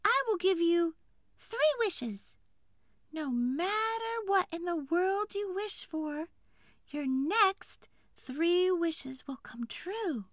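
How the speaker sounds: high, airy fairy voice, slow and grand; magical tree fairy. Acted. Tree fairy promising the woodcutter three wishes, high magical voice. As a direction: lift it up like a fairy granting wishes.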